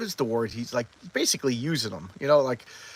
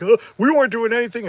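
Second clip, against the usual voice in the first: high, loud mock-innocent protest; guilty denial; 8 semitones higher, 8 dB louder than usual. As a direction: imitate someone caught out and hastily denying it. Caught as the boyfriend walks out.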